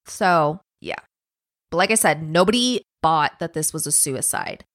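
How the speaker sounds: ordinary talk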